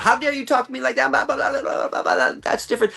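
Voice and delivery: high-pitched imitation of an outraged rant; mock screaming. Imitating the woman screaming back at him, mock screaming. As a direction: mimic her indignant screaming, far above your own voice. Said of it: "let her scream out".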